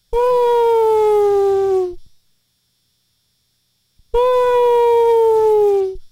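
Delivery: very high, loud whale call; squealing whale call, way up high; whale impression. Shown as a demonstration. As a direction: belt a high whale song. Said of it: "here's my whale impression".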